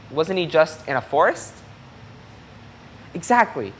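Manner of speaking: gentle storybook read-aloud; reading to children. A narrator's reading. Teacher reading a picture book to young listeners, gently. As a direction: read it like story time for little kids.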